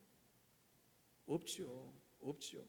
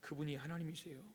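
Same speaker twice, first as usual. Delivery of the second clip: ordinary talk, nothing marked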